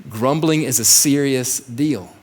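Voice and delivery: normal talking voice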